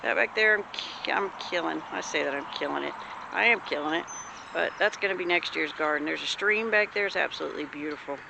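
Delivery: ordinary delivery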